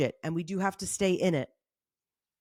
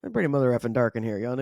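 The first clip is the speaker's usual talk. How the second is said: mock complaining; 8 semitones lower, faster than usual. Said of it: "every time I'm like".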